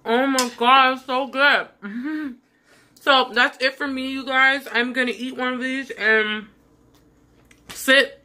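talking with a mouthful of food; mouth full. Real, not acted. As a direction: chew and talk at once. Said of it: "I'm sorry for talking with my mouth full".

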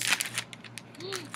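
sharp high gasp; surprised gasp. Real, not acted. Spots blue raspberry flavour.

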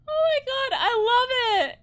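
high, loud excited cry; delighted squeal. Real, not acted. Player squealing at the game's accurate drawing. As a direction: squeal with glee. Overjoyed reaction while playing.

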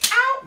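sudden high, loud "ow"; comic yelp of pretend pain; mock pain yelp. Stretching a balloon for children, hammed-up pained yelp.

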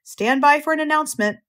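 high-pitched public-address notice; lightly booming fairground announcer; booming loudspeaker. A narrator's reading. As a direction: tell the crowd to stand by. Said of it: "boomed the voice of the loudspeaker".

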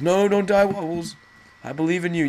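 high, loud desperate plea; pleading. Real, not acted. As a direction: beg him not to die. Pleading with teammate.

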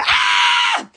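sudden high scream of fright; a short high scream at the creature beside her; startled scream. Real, not acted. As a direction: shriek at the shock.

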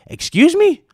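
high squeaky disbelief; sudden shocked high excuse me; incredulous. Real, not acted. Reacting to a gamer's complaint, shocked disbelief.

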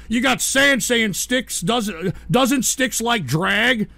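usual speaking voice

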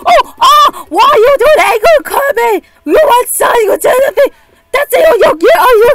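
high, loud frantic screams at the game; shrieking in real panic at the screen; panicked screaming. Real, not acted. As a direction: scream at Kirby as he attacks.

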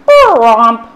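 loud, blaring brass bromp; trombone bromp. Acted. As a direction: blast it like a trombone. Imitating a trombone slide.

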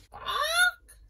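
high loud cluck; chicken squawk. Acted. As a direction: squawk like a hen. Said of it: "cackled the chicken".

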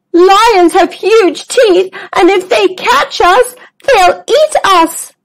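a fearful little zebra explaining; worried zebra. Acted. Zoe fears lions.

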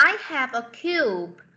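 slow, carefully read English sentence; slow teacher read-aloud; slow model reading. Shown as a demonstration. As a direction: read it slowly for the class. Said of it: "teacher reads the model sentence".

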